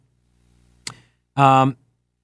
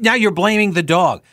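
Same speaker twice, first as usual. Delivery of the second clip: ordinary talk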